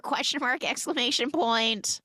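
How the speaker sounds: high-pitched baffled punctuation; incredulous. Real, not acted. Reacting to them buying the diner, incredulous. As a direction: voice shooting up in disbelief.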